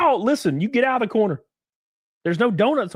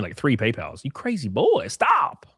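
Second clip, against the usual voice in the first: ordinary talk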